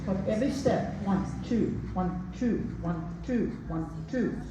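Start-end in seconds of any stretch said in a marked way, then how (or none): 1.0-4.4 steady rhythmic one-two count, fading softer. Shown as a demonstration. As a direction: chant the beat of each step. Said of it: "That's what you want to create".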